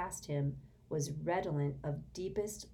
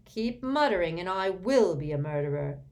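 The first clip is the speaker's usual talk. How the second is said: louder, irritated threat; irritable; 3 semitones higher, 7 dB louder than usual. A narrator's reading. Sirius threatens the muttering elf, irritated and louder. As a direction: warn him with real irritation. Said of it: "said Sirius irritably".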